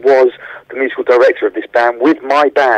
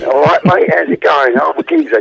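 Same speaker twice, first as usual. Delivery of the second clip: put-on English accent; 5 semitones higher than usual. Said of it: "he does this English accent".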